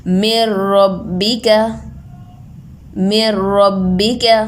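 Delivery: मिर रब्बिका slow, र doubled, no nasal hum; merged without nasal. Shown as a demonstration. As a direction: recite मिर रब्बिका slowly, no गुन्ना. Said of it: "गुन्ना के बगैर मिला दिया हमने".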